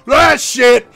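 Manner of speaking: loud high yelp of pain; pained shout. Acted. Struck patron cries out in pain, loud shout. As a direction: cry out when hit. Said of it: "It's like".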